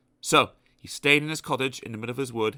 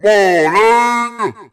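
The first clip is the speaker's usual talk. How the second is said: higher, very loud greeting; shouting postman; 9 semitones higher, 12 dB louder than usual. Acted. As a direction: yell it at the door. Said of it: "shouted the postman".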